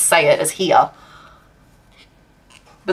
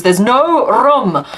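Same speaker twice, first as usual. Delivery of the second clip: drawn-out British "There's no room", with room said with a long oo; slow, pointed British line: "There's no room"; mock British "There's no room"; British accent quote; 5 semitones higher, 3 dB louder, much slower than usual. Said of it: "where a British person goes".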